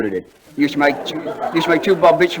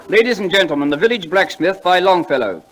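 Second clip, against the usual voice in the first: grand announcement; 6 semitones higher, 3 dB louder than usual. Acted. Dwyer announcing the poem to the audience, grandly. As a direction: announce it high and loud, like a stage compere.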